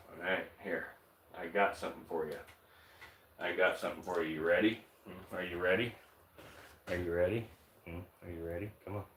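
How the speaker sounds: murmuring to a picky snake at feeding time; soft coaxing to a pet. Real, not acted. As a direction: coax her gently to take the food. Coaxing female snake to eat.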